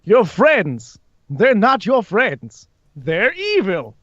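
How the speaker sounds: high, slow theatrical warning; insinuating high villain voice; Alastor, scheming. Acted. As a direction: draw each word out in a high, swooping voice. Plays Alastor.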